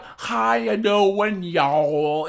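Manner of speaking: mock Southern drawl, loud and stretched; badly faked Southern twang, slowed right down; bad Southern accent. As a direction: imitate a failed Southern accent. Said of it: "she tried to do a Southern accent".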